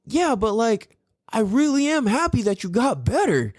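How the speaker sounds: a touch of gentle sincerity; son's sincere reply. Retold or imitated.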